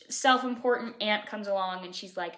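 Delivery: ordinary delivery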